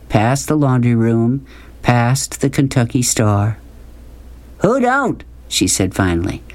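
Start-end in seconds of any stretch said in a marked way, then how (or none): 4.6-5.2 high, drawn-out rhetorical question; woman's folksy retort. A narrator's reading. Woman finally answers the boy, folksy high retort. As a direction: toss it back high, falling at the end.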